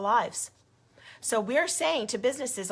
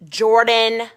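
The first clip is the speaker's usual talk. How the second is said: calls the name out hard and much louder; angry shout; 13 dB louder than usual. Real, not acted. Calling out the staffer angrily.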